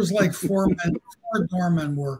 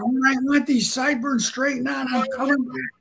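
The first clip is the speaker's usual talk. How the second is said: hollering; 7 semitones higher than usual. Retold or imitated. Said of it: "Willie's hollering like crazy".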